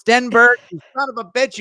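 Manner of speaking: loud, high mock outrage at a friend; hammed-up yell at the co-host; mock outraged shout. Real, not acted. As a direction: bellow his name in fake fury.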